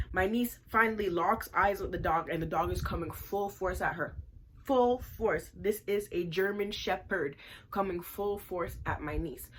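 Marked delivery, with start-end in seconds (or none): none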